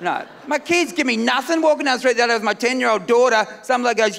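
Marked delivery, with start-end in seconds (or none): none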